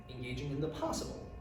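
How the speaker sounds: ordinary delivery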